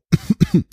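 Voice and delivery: short dry cough. Real, not acted. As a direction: clear the throat with a cough. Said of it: "ein kleines Husterchen".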